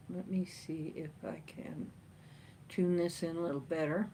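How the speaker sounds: whispered mutter to self; whispered aside. Real, not acted. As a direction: whisper it under your breath. Fiddling with phone, muttering.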